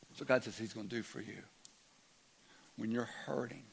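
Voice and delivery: ordinary talk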